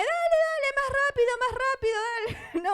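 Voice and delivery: a shrill tempting inner voice hurrying her on; nagging little voice. Acted. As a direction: play the pushy little voice in your head. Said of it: "la otra vocecita que ahí me está carcomiendo".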